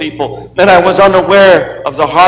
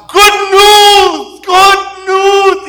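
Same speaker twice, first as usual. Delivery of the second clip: Bernie shouting; much slower than usual. Retold or imitated. Bernie shouting into the circle of mocking boys. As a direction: burst it out high and thrilled.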